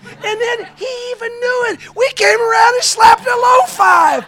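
loud, high triumphant shouting; whooping, over-the-top retelling; excited high-five reenactment. Retold or imitated.

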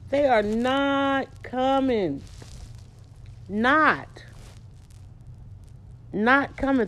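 a lone caller wondering where everyone is; mock disbelief. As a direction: stretch out they are not coming. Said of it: "you wonder, like".

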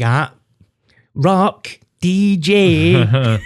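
slow, high showman drawl; mock rock star voice. Boasting to his mate about his future self, drawn-out mock swagger.

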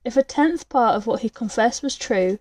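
usual speaking voice

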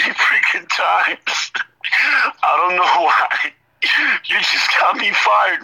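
high-pitched mock sobbing complaint; pretend crying. Debtor cursing the collection agent for his firing, pretending to cry. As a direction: wail it brokenly as a hammed-up tearful act. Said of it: "pretending I'm crying".

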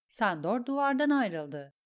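plain reading voice

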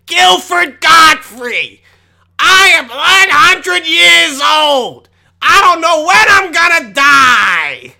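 high, slow, shouted squawk; Gilbert Gottfried impression. Vlogger does a Gilbert Gottfried squawk, high, slow and shouted. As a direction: screech it out slow like a cranky old comedian. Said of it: "doesn't sound like Gilford Godfrey".

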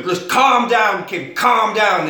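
loud, slow, emphatic mock plea; acted shouting. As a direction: shout it down the phone at a panicking wife. Husband trying to calm hysterical wife.

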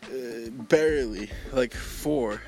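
ordinary talk

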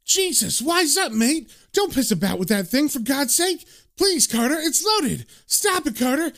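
high-pitched, alarmed begging; acted rising panic, not shouted; panicked pleading. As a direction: plead with a mate to stop. Friend begs with shotgun aimed at him.

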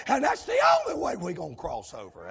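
loud, driving revival preacher delivery; shouted sermon cadence; full-force preaching. Real, not acted. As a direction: preach it out hard, phrase by phrase.

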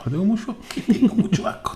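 high, breathy laugh through words; laughing whisper. Real, not acted. Repeated, laughing.

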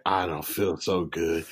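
low, slow mumble of a man feeling ill; slow, drained mock-sick voice; weak and queasy. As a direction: sag into it like you might faint. Stanley quoted after stabbing.

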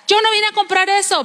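high-pitched mock protest of a husband. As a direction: act out an irritated husband objecting. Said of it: "alzaba mucho la voz el hombre". (marido alzando la voz, imitado)

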